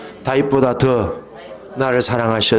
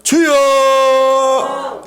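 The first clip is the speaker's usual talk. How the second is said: very high, drawn-out cry of 주여; a fervent, drawn-out Lord; long cry; 11 semitones higher, 4 dB louder, much slower than usual. Shown as a demonstration. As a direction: stretch 주여 into a long wailing call. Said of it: "주여, 시작".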